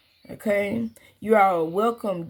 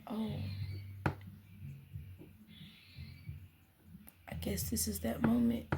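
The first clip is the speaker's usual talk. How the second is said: soft resigned whisper; 13 dB quieter than usual. Retold or imitated. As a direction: breathe it out barely voiced. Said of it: "And I said".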